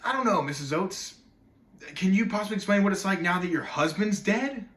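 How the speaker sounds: loud, pointed comeback, pitch lifting at the end; biting retort. Acted. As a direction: throw the question back at the interviewer. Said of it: "I wanted him so bad to say".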